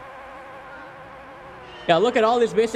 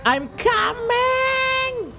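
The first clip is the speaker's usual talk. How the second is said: high, long drawn-out call; much slower than usual. Real, not acted. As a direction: call it slowly across the room. Calling out to someone away.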